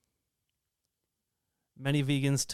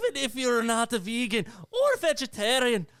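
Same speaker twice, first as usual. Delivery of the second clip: high, lilting Irish accent attempt; 9 semitones higher than usual. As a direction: go for a Scottish lilt, land Irish. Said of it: "That's, that's Irish".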